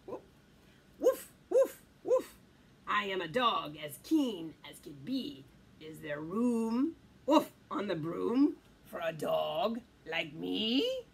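deep dog voice with woofs; low doggy character, barking between phrases; eager dog. Acted. Dog asking the witch for a ride, eager. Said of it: "then eagerly said".

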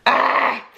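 sudden loud cry breaking into a high laugh; yelp, then crack up; startled yelp. Real, not acted.

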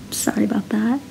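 whispered apology. Real, not acted. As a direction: breathe it, no voice.

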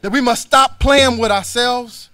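ordinary talk, nothing marked

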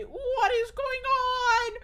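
high cracking yelp, slow and strained; nervous voice breaking into a squeak. Real, not acted. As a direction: let the voice crack up high mid-shout. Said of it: "My voice is cracking all over the place today".

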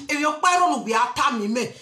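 ordinary talk

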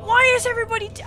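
high, loud wail of disbelief; real dismayed cry; distraught protest. Real, not acted. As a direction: plead loudly against what just happened. Districts collapsing in game.

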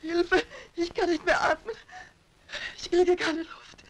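gasping for air in panic; choking, desperate cry for help; wheezy voice. Acted. Trapped woman suffocating, crying.

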